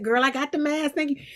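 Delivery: a hint of a high girlfriend voice; Octavia Spencer. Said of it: "that was my impression of Octavia doing herself".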